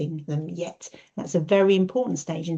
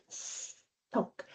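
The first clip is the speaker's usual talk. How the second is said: whispered sock; 13 dB quieter than usual. Shown as a demonstration. Modelled answer.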